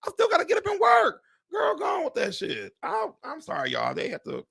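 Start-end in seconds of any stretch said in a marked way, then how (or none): 0.0-1.2 squealing repeat. Real, not acted. Repeating her complaint to viewers, higher and faster squeal. 1.5-2.7 high, dismissive brush-off; sassy dismissal. Real, not acted. Telling the dream of retiring to go away, sassy high brush-off.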